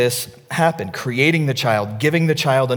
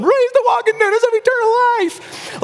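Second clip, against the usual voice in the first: very high, strained scream; mock a weepy yell over applause; 3 dB louder than usual. Father baptizing his son over applause, weepy scream. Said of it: "screaming in the microphone".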